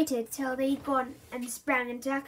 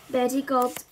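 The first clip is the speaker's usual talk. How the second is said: plain reading voice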